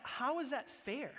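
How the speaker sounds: "How is that fair?" thrown up high and offended; indignant protest. Retold or imitated. Pastor reliving his outrage at the verse, high indignant. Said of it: "what I was feeling".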